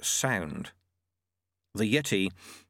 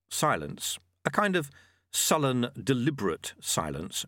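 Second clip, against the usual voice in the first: ordinary delivery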